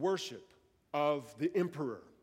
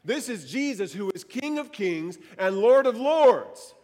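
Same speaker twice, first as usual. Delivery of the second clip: preacher repeats it louder to rouse the room; louder preaching; 4 semitones higher, 8 dB louder than usual. Real, not acted. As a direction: lean in and proclaim it louder. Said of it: "how could this room stay silent when we say that".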